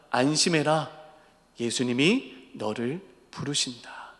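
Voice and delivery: soft, low, slow comfort; calm him with hushed good news; gentle reassurance. Acted. Preacher as a disciple reassuring Bartimaeus, soft and slow. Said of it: "제자가 바디메오에게 가서 말합니다".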